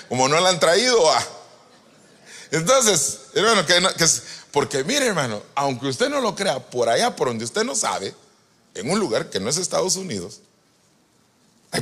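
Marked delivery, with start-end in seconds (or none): none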